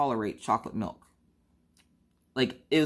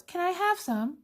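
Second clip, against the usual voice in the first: put-on much higher, pleading small child voice; little kid begging. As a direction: whine it like a kid who wants your food.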